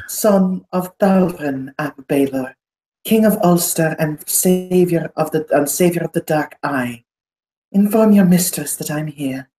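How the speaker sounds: high, formal aristocrat; grand noble. Acted. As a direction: announce yourself with pomp. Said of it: "He will say".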